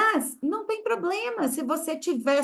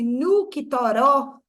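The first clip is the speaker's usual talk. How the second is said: put-on Minas accent; 6 dB louder, much slower than usual. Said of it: "tipo assim".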